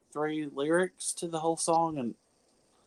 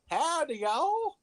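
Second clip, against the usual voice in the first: higher, slow Texan howdy; mock Texas drawl; 8 semitones higher, much slower than usual. Answering a Texas joke in a hammed-up Texan drawl. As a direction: drawl it like a cowboy. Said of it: "Y'all from Texas".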